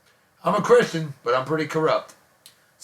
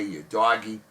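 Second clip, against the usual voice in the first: ordinary talk, nothing marked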